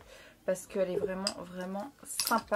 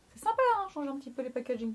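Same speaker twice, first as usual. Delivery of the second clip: delighted; 5 semitones higher, 5 dB louder, faster than usual. Real, not acted. Delighted at new packaging.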